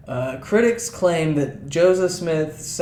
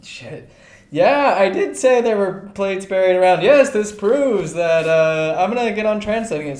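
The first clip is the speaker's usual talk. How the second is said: mock Joseph Smith; 6 semitones higher, 5 dB louder than usual. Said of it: "he's like".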